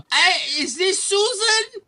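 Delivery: slow, very high falsetto question; squeaky caller, stretched out; mocked telemarketer. Cold caller asking for Susan, slow mocking falsetto. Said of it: "they go".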